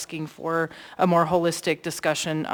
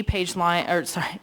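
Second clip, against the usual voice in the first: ordinary delivery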